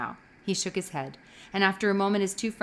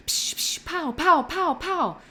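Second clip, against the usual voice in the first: gun noises; 9 semitones higher, 4 dB louder, slower than usual. A narrator's reading. Child makes gun noises.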